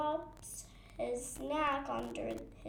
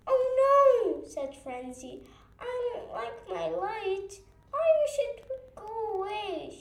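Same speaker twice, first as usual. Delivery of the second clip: upset; 6 semitones higher, 7 dB louder than usual. A narrator's reading. Frenzy upset about her light.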